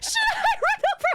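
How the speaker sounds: high, loud laughing shriek; mock shriek. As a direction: shriek it like a panicked meme quote. Quotes a viral audio clip.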